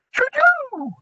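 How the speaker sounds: very high loud owl hoot; whirring owl. A narrator's reading. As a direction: whir it like an owl. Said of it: "whirred the owl".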